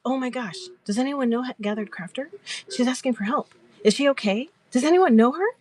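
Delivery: imitated concern. Host thinks a viewer typed help, voiced with concern. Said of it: "all that concern she had for me".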